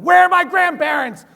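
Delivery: furious high-pitched action-hero shout; yelling. Acted. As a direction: bellow it at the man you have pinned down. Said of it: "I'm yelling at him".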